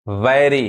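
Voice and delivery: वेरी as 'VAE-ree', open vowel; taught open vowel. Shown as a demonstration. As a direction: say वेरी like English 'vary'. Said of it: "यहाँ ए sound". (वेरी को 'वैरी' बोलें)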